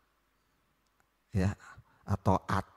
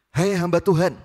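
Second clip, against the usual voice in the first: higher, louder rude shout of the title; arrogant call; 9 semitones higher, 8 dB louder than usual. Acted. Soldier hailing the man of God, rude shout. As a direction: yell up at him with contempt. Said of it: "kita bahasakan dengan bahasa sekarang".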